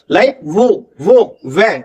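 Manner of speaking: वो and वह with rounded lips, no teeth on lip; W sound demo. Shown as a demonstration. Teacher shows students the W sound, rounding lips on वो and वह. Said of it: "वी से मिलता जुलता होता है".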